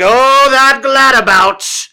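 put-on loud, high old-timey scolding, words drawn out; outraged townsfolk. Townsfolk gossiping about the hot toddy drinker, outraged shouting. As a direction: cry out like a scandalized crowd. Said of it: "Everyone's like".

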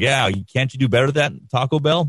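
mock challenge. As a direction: imitate a pal's teasing challenge. Quotes buddy goading Taco Bell on air.

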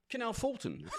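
lightly British, high answer; British accent. Pretend. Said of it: "the British thing".

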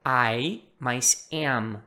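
"I" and "am" very slow and apart; separated parts. Shown as a demonstration. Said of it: "é a junção do". ("I" e "am" separados, bem devagar)

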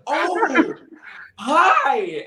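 high, loud, flustered hello; overwhelmed fan's high-pitched greeting; starstruck greeting. Retold or imitated. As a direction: re-enact greeting your hero in shock. Retold greeting to a famous caller.

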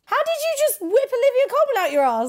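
high, loud amazed disbelief. Real, not acted. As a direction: gasp it out in astonishment. Amazed reaction to correct guess.

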